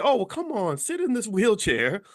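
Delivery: put-on baby him like a doting relative; mock pity. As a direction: enabler coddling someone in a wheelchair, mock pity. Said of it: "They, they say".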